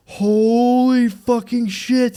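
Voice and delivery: put-on overwhelmed by seeing the baby; drawn-out high wonder; stunned awe. Stoned reaction to the baby.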